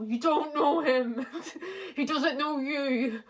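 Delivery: slow, high, laughing mock lecture; mocking family member. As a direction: sneer it slowly like nagging relatives. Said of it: "my family would have to go".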